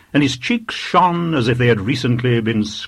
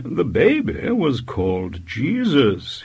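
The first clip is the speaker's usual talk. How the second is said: slow, pointed, raised-pitch correction; slow and deliberate; 5 semitones higher than usual. A narrator's reading. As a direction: spell it out word by word to a child. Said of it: "slowly and deliberately".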